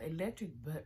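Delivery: usual speaking voice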